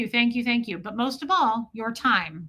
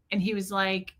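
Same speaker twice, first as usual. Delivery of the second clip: usual speaking voice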